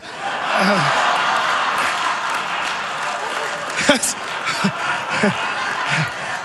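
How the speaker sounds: long airy laugh at his own joke; long breathy laugh. Real, not acted. Audience-facing big laugh.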